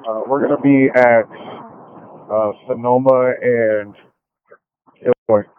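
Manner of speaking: police radio. Real, not acted. Officer radio reply to dispatch.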